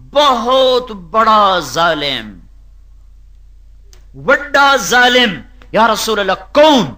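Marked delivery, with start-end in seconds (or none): 0.1-5.4 very slow emphasis. Real, not acted. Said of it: "preacher stressing tyrant".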